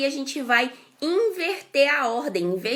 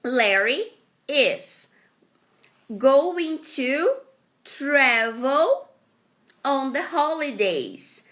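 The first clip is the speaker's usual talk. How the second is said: slow word by word; much slower than usual. Shown as a demonstration. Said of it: "Então".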